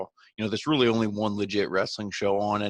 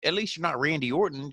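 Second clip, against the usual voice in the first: mocking Miz voice; 8 semitones higher than usual. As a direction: toss it off like a gloating heel. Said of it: "it's like".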